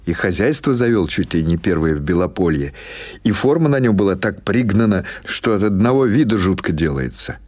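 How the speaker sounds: ordinary delivery, nothing marked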